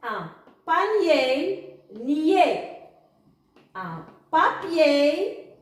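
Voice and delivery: slow word rising, then short falling last syllable; syllable-reading drill. Shown as a demonstration. Teacher repeats the words for pupils, slow syllable drill. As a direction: model each word slowly, then its ending alone. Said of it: "Alors, je répète".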